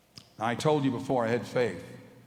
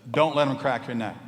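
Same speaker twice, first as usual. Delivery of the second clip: loud warning inner voice; the voice in his head insisting; stern inner warning, pushed loud; 3 semitones higher, 7 dB louder than usual. Retold or imitated.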